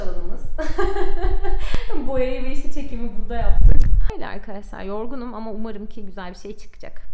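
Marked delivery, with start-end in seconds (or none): 0.5-2.0 laugh. Real, not acted. Laughing at the messy work area.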